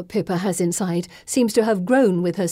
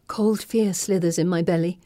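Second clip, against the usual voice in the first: plain reading voice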